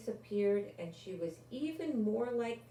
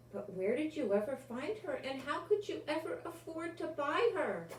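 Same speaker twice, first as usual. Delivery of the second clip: astonished; 7 semitones higher than usual. Acted. Maggie astonished.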